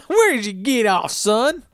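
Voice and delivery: high, put-on Texan twang for Horace; jokey Texas cowboy accent; Texas drawl. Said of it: "I'm Texas [name]".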